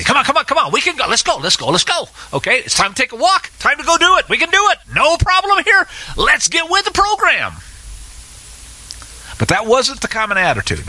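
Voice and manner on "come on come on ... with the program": high, bouncing puppy-dog excitement; eager puppy. Retold or imitated. Caleb imagined as a puppy urging everyone on, high and bouncing. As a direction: yip it out like an eager puppy begging for a walk. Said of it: "like a puppy running around and say".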